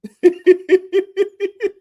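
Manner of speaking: loud high cackle at his own joke; high burst of laughter. Real, not acted. Laughing at his own mirror joke, high cackle. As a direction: let out a big high laugh.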